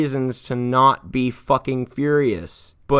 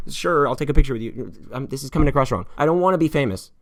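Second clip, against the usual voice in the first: usual speaking voice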